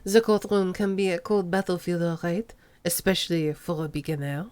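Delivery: put-on low, soft French accent, purring; smooth French accent crooning at the rookie; a hint of a lazy French accent. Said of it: "made Armando have a French accent".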